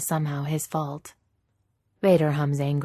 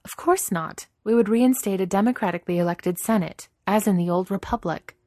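higher, crisp woman's character voice; Leia, indignant; 4 semitones higher, 4 dB louder than usual. Acted. Leia rejecting the idea she would rule, indignant. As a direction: correct them firmly, pitched up.

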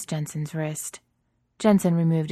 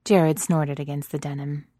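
plain reading voice

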